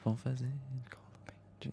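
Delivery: slow hushed whisper. Real, not acted. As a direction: whisper the cue slowly. Prompting partner mid-song.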